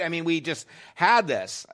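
ordinary talk

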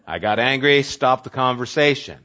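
ordinary talk

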